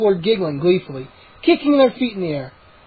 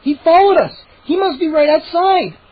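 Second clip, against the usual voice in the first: excited; 9 semitones higher, 4 dB louder than usual. A narrator's reading. Excited about dog.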